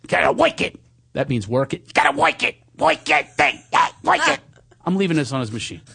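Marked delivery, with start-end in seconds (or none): 0.1-4.4 monkey talk. Acted. Leaving monkey talk on his friend's machine. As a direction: chatter it like a monkey. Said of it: "we talk monkey talk".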